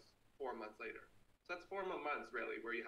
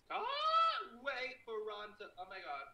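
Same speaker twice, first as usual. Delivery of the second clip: higher, stretched-out burst; excited gushing; 10 semitones higher, 8 dB louder than usual. Real, not acted. Streamer can't wait for Ron, excited high stretch. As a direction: draw it out with rising excitement. Gushing about Ron's visit.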